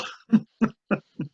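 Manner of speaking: high chuckle; real laugh at his own expense. Real, not acted.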